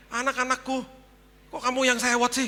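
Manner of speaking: high, loud mock protest; indignant. Father reacting to housekeeper's scolding, indignant. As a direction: protest in exasperated disbelief.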